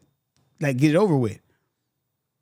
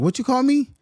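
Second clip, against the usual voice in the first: higher-pitched confronting question; retold demand, pitched up like calling someone out; indignant challenge; 9 semitones higher, 3 dB louder than usual. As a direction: call her out on it. Confronting the coworker in story.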